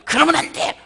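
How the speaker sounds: loud, high "그러면 안 돼!"; scolding shout. Acted. God pictured scolding a weak believer, loud angry yell. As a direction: bark it like a harsh scolding. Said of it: "윽박지르질 않고요".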